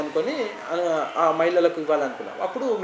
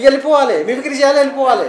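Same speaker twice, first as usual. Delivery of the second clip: louder, higher imitation of curt organizers; raised-voice retold dismissal; mock dismissive order; 5 semitones higher, 7 dB louder, faster than usual. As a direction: send the performer away, loudly. Voicing organizers dismissing unpaid performers.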